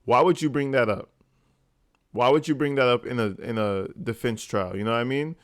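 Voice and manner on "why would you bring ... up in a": normal talking voice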